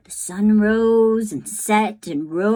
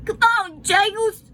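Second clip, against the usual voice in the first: put-on higher, louder resolute boy; rally yourself and the doll; boy's voice; 13 semitones higher, 5 dB louder than usual. Bryce tells his doll Jangles they are leaving, resolute.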